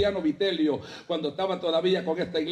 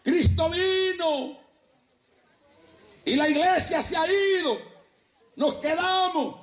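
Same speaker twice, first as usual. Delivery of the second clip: wailing shout; 10 semitones higher, slower than usual. Retold or imitated. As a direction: cry it out like panicked believers left behind.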